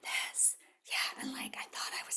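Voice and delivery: hushed, barely voiced demonstration; whisper. Shown as a demonstration. Speaker shows how she read her notes, whispering. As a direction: whisper it as if reading timidly from notes. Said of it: "And I read it in a whisper".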